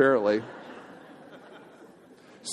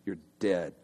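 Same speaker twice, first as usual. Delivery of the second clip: much softer and lower, deadly intent; low soft menace; 10 semitones lower, 12 dB quieter than usual. Retold or imitated. Homeowner vowing to kill the dandelion, hushed lower menace. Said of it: "And so you're like".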